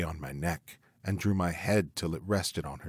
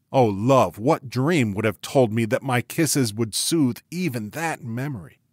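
ordinary delivery

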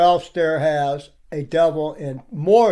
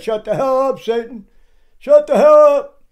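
building mock shout, higher and louder at the end; mimicked yell; 7 semitones higher, 5 dB louder than usual. Preacher imitating Stair cursing, a building mock shout. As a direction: throw the curse back at him, rising. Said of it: "after he said".

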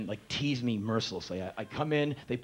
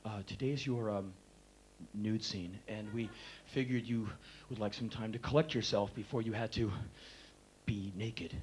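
soft, grave and hesitant; hushed solemn makeup women; intense hush; 3 semitones lower, 7 dB quieter than usual. Retold or imitated. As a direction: break it to him gently and seriously. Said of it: "they're so intense about it".

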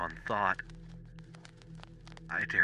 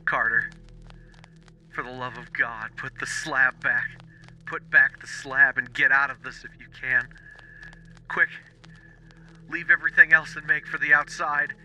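much higher, louder frantic pleading; wild consternation; 10 semitones higher, 4 dB louder than usual. Acted. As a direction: beg him to flee, frantic. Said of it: "in a pitch of wilder consternation".

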